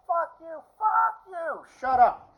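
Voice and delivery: high-pitched, squeezed "fuck you" bursts; exasperated cursing. Acted. At the too-cheerful grocery store girl, exasperated cursing. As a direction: curse them out in a high, strained voice.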